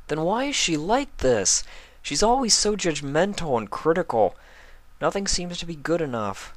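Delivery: high voiced, puzzled young boy; frustrated young dragon. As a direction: whine it like a hurt kid.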